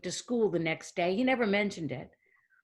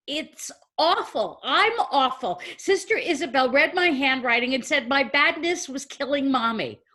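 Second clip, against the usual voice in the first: put-on higher, emphatic outpouring of a child; distraught confession; 5 semitones higher, 3 dB louder than usual. Child confesses to her dad about the nun, distraught. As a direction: blurt it all out in anguish.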